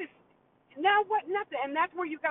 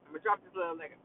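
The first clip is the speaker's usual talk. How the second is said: repeated much lower and softer, deep and weighty; 8 semitones lower, 5 dB quieter, much faster than usual. Real, not acted. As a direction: drop your voice way down and lay it on heavy. Repeats the line for emphasis.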